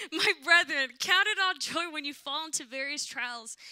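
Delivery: reading scripture through tears; loud, high, emotional burst into the verse; choked up. Real, not acted.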